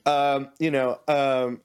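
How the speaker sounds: ordinary talk, nothing marked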